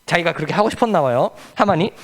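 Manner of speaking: ordinary talk, nothing marked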